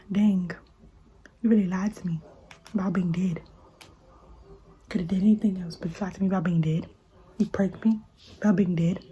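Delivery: hurt murmur. Real, not acted. Remembering the friend who faked his death, soft hurt whisper. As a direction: speak it to yourself, stung and quiet.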